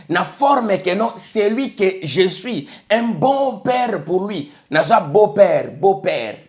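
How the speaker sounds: usual speaking voice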